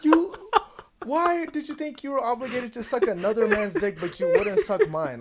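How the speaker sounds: high, loud indignant protest; exploding in high-pitched disbelief; retold explosion. Confronting his girlfriend about the other man, retold explosion. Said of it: "I just exploded".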